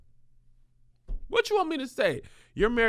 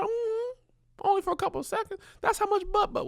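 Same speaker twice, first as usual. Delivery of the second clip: mock high voice.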